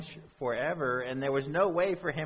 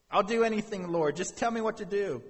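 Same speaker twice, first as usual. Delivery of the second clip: high, loud, pleading promise; acted urgent begging for instructions; desperate eagerness; 7 semitones higher, 4 dB louder than usual. Desperate man agrees to anything.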